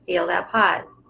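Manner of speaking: calm breathing-coach voice guiding each breath; unhurried breathing instructor; guided breathing. Shown as a demonstration. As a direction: talk them gently through inhale, pause and exhale. Leads a breathing practice.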